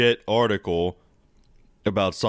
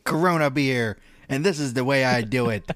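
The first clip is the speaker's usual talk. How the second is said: villain voice; 5 semitones higher than usual. Acted. Acted villain speech.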